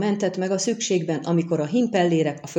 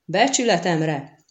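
plain reading voice

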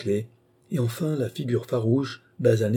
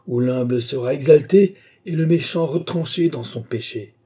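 raised, ringing zealot's sermon; impassioned preaching; 7 semitones higher, 5 dB louder than usual. A narrator's reading. Crazed worker preaches doom to trapped passengers, fervent proclamation. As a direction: proclaim it like a fanatical prophet. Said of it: "une explosion de passion".

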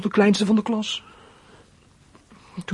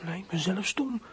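whisper; 8 dB quieter than usual. Acted. Inner thought, self-reproach.

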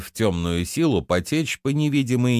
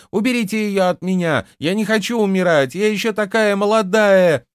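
very high, loud alarmed shriek; panicked squeal; 11 semitones higher, 5 dB louder than usual. A narrator's reading. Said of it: "завизжала она".

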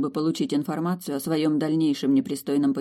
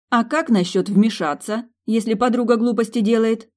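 louder indignant protest; 6 semitones higher, 6 dB louder than usual. A narrator's reading. Milly protesting to her friends, indignant. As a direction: demand it in outrage. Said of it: "возмутилась Милли".

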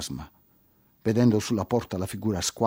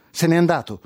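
higher, louder frightened blurt; 6 semitones higher, 4 dB louder than usual. Acted. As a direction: say it scared, in a rush. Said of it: "disse spaventata".

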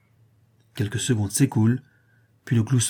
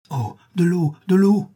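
joyful cry; 7 semitones higher, 4 dB louder than usual. Acted. Parched men find water.

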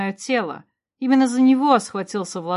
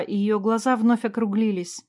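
plain reading voice

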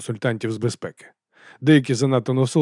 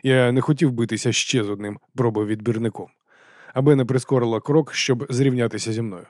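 plain reading voice

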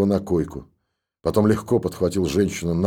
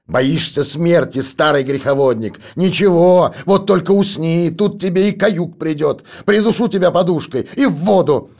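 shouting her monologue; 10 semitones higher, 4 dB louder than usual. A narrator's reading. Raving daughter shouts threats at her sleeping father, loud. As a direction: spit death threats at him. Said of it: "продолжала выкрикивать свой монолог".

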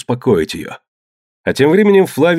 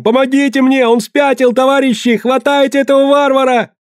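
very high, sustained scream for help; panicked high-pitched yelling; frantic cry; 4 dB louder than usual. A narrator's reading. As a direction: shriek for rescue on one high pitch. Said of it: "кричал парень".

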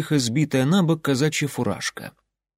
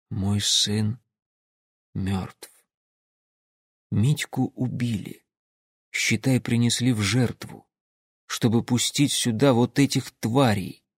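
numb grief; 3 dB quieter than usual. Acted. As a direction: speak numb with loss. Father realizing son was sacrificed.

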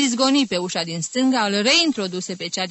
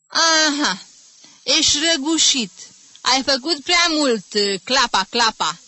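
mocking; 7 semitones higher, 4 dB louder than usual. A narrator's reading. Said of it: "îl mustră cu umor".

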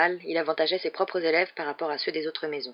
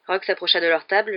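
ordinary delivery, nothing marked

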